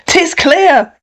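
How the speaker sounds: mild declaimed protest; townsfolk's cry. A narrator's reading. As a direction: cry it out as an angry crowd. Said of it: "cried they".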